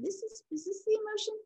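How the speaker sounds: soft, high, searching question; careful, quiet counselor's query; tentative checking-in. Acted. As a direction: wonder gently, voice lifted and softened.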